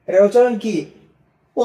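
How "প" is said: B said as unvoiced প; Bengali-style প. Shown as a demonstration. Teacher demonstrating pinyin B, pronounced প. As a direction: say প for pinyin B, drawn out. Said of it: "বাংলার পর মতো উচ্চারণ".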